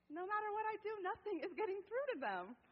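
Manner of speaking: high, loud frustrated outburst; exasperated parent. Retold or imitated. Parent whose kids won't listen, exasperated.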